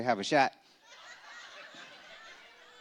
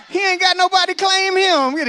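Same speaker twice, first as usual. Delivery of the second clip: much higher, louder pitying voice; high mock pity; 7 dB louder than usual. Stranger pitying stray single man, high and loud. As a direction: squeal it like spotting a stray.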